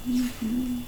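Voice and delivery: ordinary delivery, nothing marked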